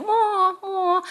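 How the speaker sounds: high, drawn-out wailing calls; mother turtle. Retold or imitated. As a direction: call out long like a turtle. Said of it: "the mother turtle saying".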